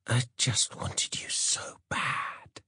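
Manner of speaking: slow, breathy whisper; seductive whisper. Acted. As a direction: whisper it slowly, feigning innocence. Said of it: "she shrugged".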